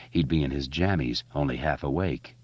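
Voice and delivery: usual speaking voice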